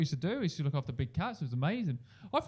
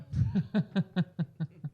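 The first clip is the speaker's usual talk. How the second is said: disbelieving laugh; 3 semitones higher than usual. Real, not acted. Laughing at his claim.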